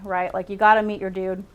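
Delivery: ordinary delivery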